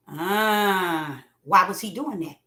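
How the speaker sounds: ordinary delivery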